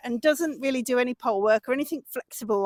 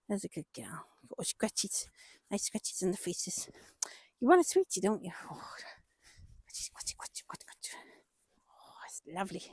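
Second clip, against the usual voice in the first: whispering; 5 semitones lower, 12 dB quieter than usual. Real, not acted. Scratching horse's face after work.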